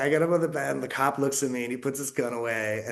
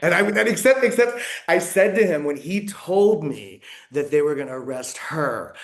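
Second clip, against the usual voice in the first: ordinary talk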